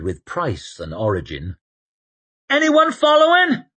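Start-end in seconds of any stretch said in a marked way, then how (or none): 2.5-3.6 high, loud call. A narrator's reading. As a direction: call it back over the wind. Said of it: "called Shai".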